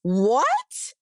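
high, flustered denial, no, no, no; mock innocent denial. Wife mocking how innocent husband would react, high flustered. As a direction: play the shocked innocent man, pitch way up. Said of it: "someone innocent would be like".

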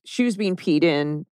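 ordinary talk

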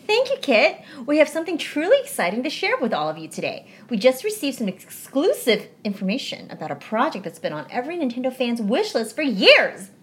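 upbeat radio-host delivery; podcast host read. Acted. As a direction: sell the news like a show host. Reading a podcast script aloud.

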